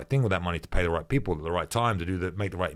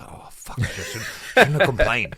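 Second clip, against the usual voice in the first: half-laughed, breathy fake regret; laughing mock regret; 5 semitones higher, 5 dB louder than usual. Regret after being made to spar.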